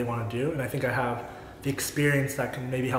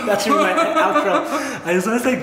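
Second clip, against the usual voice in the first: laughing; 10 semitones higher, 6 dB louder than usual. Real, not acted. Laughs at own word gayful.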